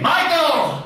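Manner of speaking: sharp high call of a name; dad's shout. Retold or imitated. Dad yelling for his son across the house. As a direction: bellow the boy's name like an angry dad. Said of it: "you hear Dad say".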